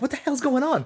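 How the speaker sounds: high, startled outburst; sudden alarmed shriek; alarmed surprise. Retold or imitated. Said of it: "I'm like".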